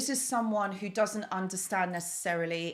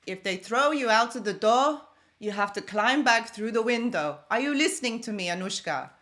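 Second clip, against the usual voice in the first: louder, firm fatherly lecture voice; imitating dad; 3 semitones higher, 6 dB louder than usual. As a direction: lecture her like her dad, full of conviction. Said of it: "he says".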